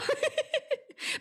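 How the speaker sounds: high-pitched giggle; high laugh. Real, not acted. Host laughing at the cat's death joke, a real laugh. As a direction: let a real laugh burst out.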